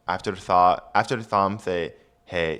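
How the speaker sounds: ordinary delivery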